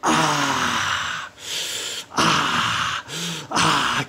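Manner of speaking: long drawn-out tea-sipper's "ah"; satisfied sigh. Acted. Faux tycoon tasting his tea, satisfied sighs. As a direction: exhale a savouring "ah" after each sip.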